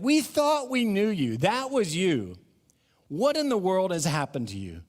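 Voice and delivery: high, incredulous townsfolk voice; a hint of stunned neighbours asking what happened; townspeople in disbelief.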